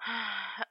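long soft exhausted sigh; bone weary sigh. A narrator's reading. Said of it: "bone weary sigh".

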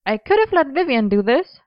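high-pitched, teasing threat; threatening. A narrator's reading. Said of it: "she threatened".

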